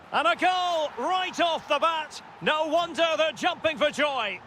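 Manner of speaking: high-pitched commentator's goal shout; excited goal call. Real, not acted.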